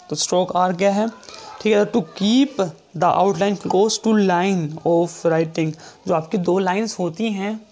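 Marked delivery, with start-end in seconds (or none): 1.9-5.7 very slow reading of the rule; teacher dictating a rule; slow dictation. Shown as a demonstration. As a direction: read it out word by word. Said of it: "reading rule slowly for notes".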